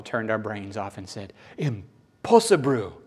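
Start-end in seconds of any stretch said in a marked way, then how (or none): 1.6-3.0 slow, hammed-up Japanese accent; mock Japanese accent. Preacher mocking our reaction to "perfect", mock Japanese accent. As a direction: draw it out in a joke Japanese accent. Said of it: "which is Japanese for impossible".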